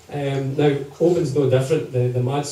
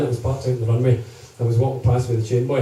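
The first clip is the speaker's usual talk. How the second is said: ordinary delivery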